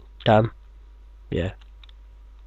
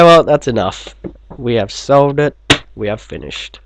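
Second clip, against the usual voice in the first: usual speaking voice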